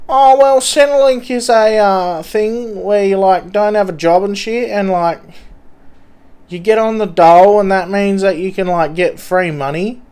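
a dazed street addict explaining; twitchy slurred sniffing addict. Pretend. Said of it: "Mister Junkie from train station".

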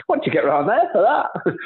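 high, surprised mock question. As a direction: echo the disbelief lightly. Mimicking colleague's surprised question.

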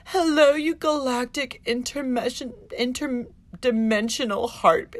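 high, drawn-out gushy video greeting; mock a woo-woo YouTuber's sing-song intro; overdone cosmic hello; mocking spiritual influencer. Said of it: "she starts her videos with".

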